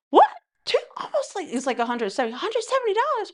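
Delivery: very high-pitched, stunned disbelief; mock shock. Girlfriend handed almost two hundred bucks, high-pitched mock shock. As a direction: squeal it like a floored girlfriend. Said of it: "she's looking at him like".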